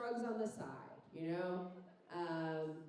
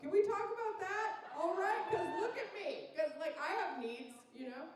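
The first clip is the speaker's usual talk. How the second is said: mock outrage; 9 semitones higher than usual. Indignant rant about sister's surgery.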